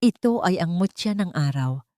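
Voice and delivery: ordinary delivery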